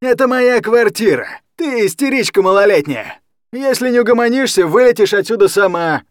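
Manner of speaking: high, loud threatening shout. Acted. Drunk guardian shouting threats at Anya. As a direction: yell the threat back at her. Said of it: "и сам перешёл на крик".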